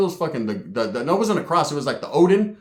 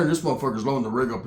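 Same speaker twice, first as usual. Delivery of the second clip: usual speaking voice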